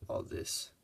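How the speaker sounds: usual speaking voice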